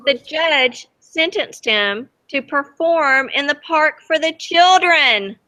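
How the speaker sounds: reading a picture book aloud to the class; lively read-aloud for kids; storybook reading. A narrator's reading. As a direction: tell it gently to children, letting the wonder show. Teacher reads story to children.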